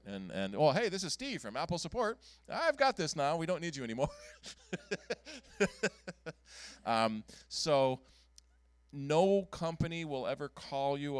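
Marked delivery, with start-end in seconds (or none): 0.5-4.1 higher, bright mock-official brush-off; playful mock brush-off, higher pitch; mock cheery reply. Mocking reply to the scammer. 4.1-6.3 high laugh at his own joke; real amused laugh. Real, not acted.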